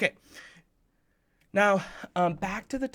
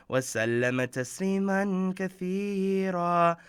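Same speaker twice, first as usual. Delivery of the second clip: fast, formal recited opening; Arabic invocation. Acted. Preacher opens the kids' khutbah with a recited Arabic blessing.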